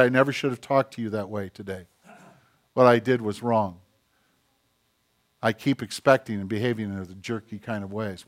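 low, soft confession with pauses; acted apology, hushed and humble; sincere apology. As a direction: own up quietly, voice dropped. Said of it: "the way they need to be given".

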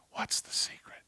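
hushed secret whisper of What's the secret. Acted. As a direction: whisper it like sharing a secret with kids. Pastor whispers to kids.

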